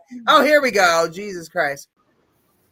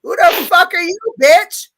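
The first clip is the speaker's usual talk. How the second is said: mock angry rant; 6 semitones higher than usual. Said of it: "and I'm like".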